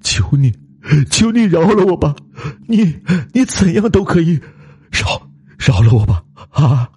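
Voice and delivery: high, sobbing plea, breaking into stammers; incoherent begging. Acted. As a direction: beg desperately for your life. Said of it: "他已经话不成话". (语不成声地哭着求饶)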